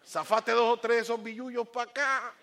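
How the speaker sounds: loud, high, brash holler; disrespectful street demand. Acted. Cheeky son demanding money from dad, brash holler. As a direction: call out cheekily for the cash. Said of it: "a faltarle respeto".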